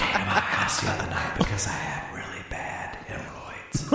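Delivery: laughing mock whisper. Mock sexy Craigslist ad.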